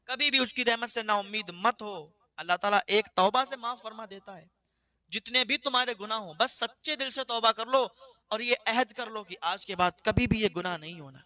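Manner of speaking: preacher exhorting listeners to sincere repentance, fervent; fervent preaching. Acted. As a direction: urge the listeners never to lose hope. Preaches to audience.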